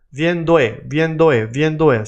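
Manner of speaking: "viendo e" run together, rhythmically repeated; repeat-after-me linking drill. Shown as a demonstration. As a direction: drill "viendo e" as one linked word. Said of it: "Say it with me".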